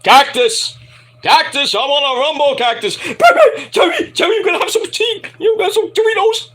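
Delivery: calling out to Cactus in Terry's voice; manic Terry Funk. Acted. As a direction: holler like a wild old man.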